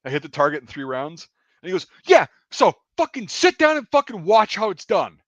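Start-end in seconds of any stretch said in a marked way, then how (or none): 2.0-5.2 high, loud imitated angry order; blowing up. Gunner telling off range safety, blowing up. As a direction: shout him down, mimicking a furious gunner. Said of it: "him blowing up at him".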